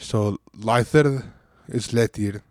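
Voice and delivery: "lyther" then "letir", stretched slowly; slow pronunciation demo. Shown as a demonstration. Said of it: "I becomes e in Western".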